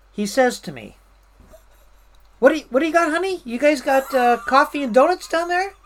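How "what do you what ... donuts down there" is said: high, louder mocking question; mocking condescension. Division chief brushing off the supply worker, mockingly condescending. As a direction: talk down to them in an imitated voice. Chief's dismissive retort, acted.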